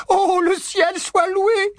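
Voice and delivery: high, slow, tearful wail; sobbed relief. A narrator's reading. Mrs Weasley thanks heaven for her husband, sobbed relief. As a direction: wail it loudly with overwhelmed relief. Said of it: "sanglota Missus Weasley".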